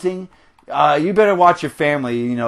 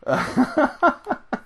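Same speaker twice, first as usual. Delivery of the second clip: high giggle; 10 semitones higher, 3 dB quieter than usual. Real, not acted. Laughing at own rant.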